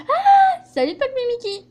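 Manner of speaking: jumps high and loud on Ah; cheerful shout of greeting; excited high greeting. Acted. (salut très enjoué et aigu)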